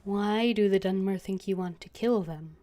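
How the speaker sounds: usual speaking voice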